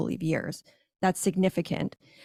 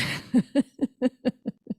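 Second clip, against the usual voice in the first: amused laugh; 6 semitones higher than usual. Real, not acted. Laughs at own irony.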